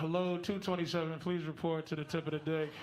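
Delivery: page the load like an announcer; PA announcement. Acted. Mock loudspeaker announcement.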